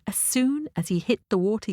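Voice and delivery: usual speaking voice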